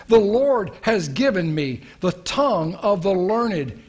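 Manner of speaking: preacher reciting psalm verses in fervent praise; scripture recitation. Acted. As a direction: proclaim the verses like a prayer.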